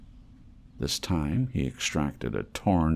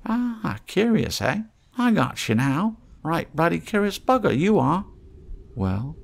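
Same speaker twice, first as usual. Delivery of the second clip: higher, sly haggler's voice; wheedling trader voice; 11 semitones higher, 4 dB louder than usual. Acted. Scruffy trader teasing the mole, higher and sly. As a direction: coax the stranger like a street trader.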